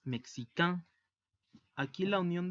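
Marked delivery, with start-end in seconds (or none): 0.0-0.9 "mexicain" ending in a nasal AN sound; teacher demonstrating the French masculine form; French pronunciation demo. Shown as a demonstration. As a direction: model "mexicain" with a strong nasal final vowel. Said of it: "la pronunciación es".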